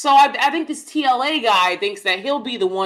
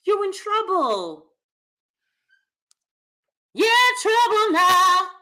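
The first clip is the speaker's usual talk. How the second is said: mock warning; 10 semitones higher than usual. Mocking warning in put-on voice.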